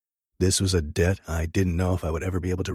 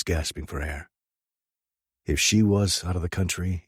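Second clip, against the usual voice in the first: usual speaking voice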